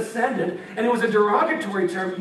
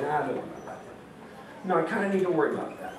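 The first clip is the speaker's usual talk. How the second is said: lower, softer, then rushed; offhand dismissal; 5 semitones lower, 7 dB quieter, much faster than usual. Retold or imitated. Preacher voicing a careless soldier brushing off swords, low, then fast. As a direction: shrug it off, then correct yourself fast.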